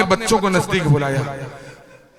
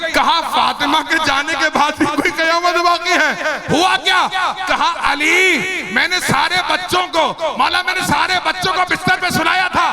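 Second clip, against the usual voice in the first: high, urgent grieving narration; impassioned mourning preacher; majlis lament; 8 semitones higher than usual. Acted. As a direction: drive the tragic story on in anguish. Urgent lamenting story at full force.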